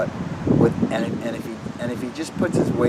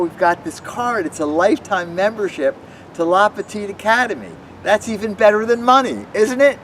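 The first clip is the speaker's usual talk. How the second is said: put-on children's magic show patter; 4 semitones higher than usual.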